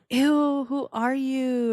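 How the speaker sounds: high gasp, then a slow "who are you"; mock gasp of disgust. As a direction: act scandalised. Mock shock at Fortnite.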